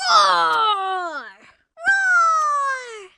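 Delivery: loud high pretend roar; dragon roar. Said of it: "the dragon roared".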